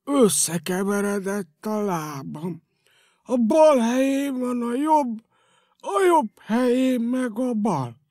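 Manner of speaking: slow, wondering dismay. Acted. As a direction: spell out the mix-up slowly, alarmed. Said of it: "rémülten".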